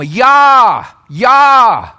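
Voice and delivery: Yah said loud and short; Yah, the short name, called loud; short form Yah. Shown as a demonstration. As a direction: punch out Yah. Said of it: "short form".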